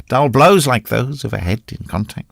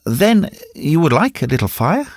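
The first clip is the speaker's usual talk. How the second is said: mildly naive, higher-pitched question; innocent; 5 semitones higher than usual. A narrator's reading. Oudard offering fire to the recluse, innocent question. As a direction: ask it in all innocence. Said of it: "replied innocently".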